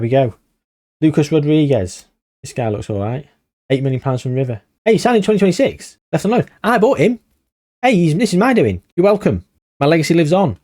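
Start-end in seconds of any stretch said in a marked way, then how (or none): 4.8-10.6 delighted, smug gloating; proud excitement. Real, not acted. Finds his own old signing.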